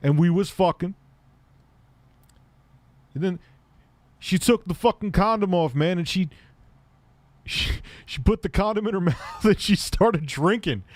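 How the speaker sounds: imitating Cam. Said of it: "And he was like".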